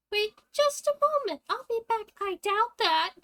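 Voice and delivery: high, loud call; urgent outburst. Acted. Acted urgent exchange after injury.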